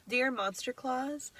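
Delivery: usual speaking voice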